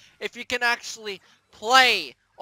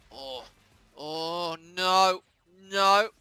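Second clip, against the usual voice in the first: ordinary delivery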